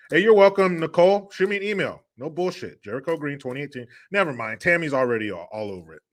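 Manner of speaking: ordinary talk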